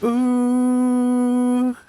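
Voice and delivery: high breathy singer's "Ooh"; [name] "Ooh". Retold or imitated. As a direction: imitate the meme's falsetto "Ooh". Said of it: "that meme whenever I think of [name]".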